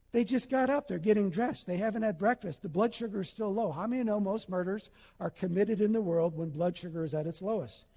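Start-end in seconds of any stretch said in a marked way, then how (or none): none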